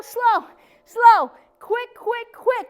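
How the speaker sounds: high-pitched beat, strong "slow", light "quick"; rhythmic workout count. Shown as a demonstration. Instructor with the sets running down, rhythmic cadence. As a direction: drive the class on with a chanted count. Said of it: "we're gonna do quick".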